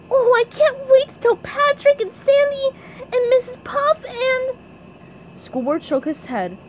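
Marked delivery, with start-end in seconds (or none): 0.1-4.6 high, loud, excited cartoon squeal; SpongeBob. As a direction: bubble over in a squeaky pipsqueak voice. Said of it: "SpongeBob grinned".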